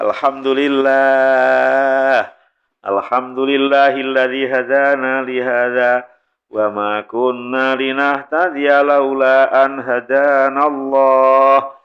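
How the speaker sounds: meniru khotib lambat dan datar; khotbah membosankan. Retold or imitated. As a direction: lantunkan pelan seperti khotbah yang bikin ngantuk. Meniru khotbah yang meninabobokan. (meniru khotib lambat yang bikin jamaah tertidur)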